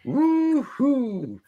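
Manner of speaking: high, drawn-out fake whoop; mock cheer. Fan dreading next Wolverine film, mock cheering. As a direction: cheer without any extra volume.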